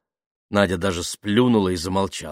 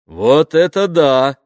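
booming, drawn-out amazement, pitched up; loud exclamation; 7 semitones higher, 10 dB louder, slower than usual. A narrator's reading. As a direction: butt in loudly from the next table. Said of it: "громко раздалось сбоку".

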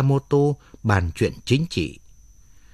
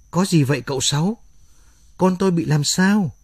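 rising alarm, pitch climbing higher on the question; a frightened young mother asking what is wrong; anxious mother; 6 semitones higher than usual. A narrator's reading. Mother fears for her baby. (giọng lo sợ của người mẹ)

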